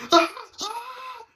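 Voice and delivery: sudden high mock yelp; retold sudden yelp; imitated outburst. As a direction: blurt out a high startled cry. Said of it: "she just starts going".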